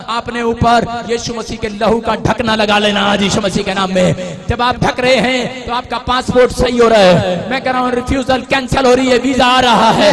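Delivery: loud, rising sermon proclamation; fiery pulpit declaration; full-force preaching. Acted. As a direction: preach it out to the whole hall. Preacher building to a climax.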